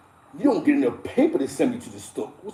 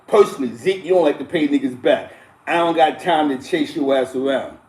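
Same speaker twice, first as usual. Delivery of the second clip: ordinary delivery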